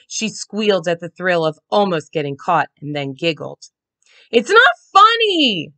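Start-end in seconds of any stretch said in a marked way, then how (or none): 4.3-5.7 high, loud angry howl; boy howling in anger. A narrator's reading. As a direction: howl it after her. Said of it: "Josh howled".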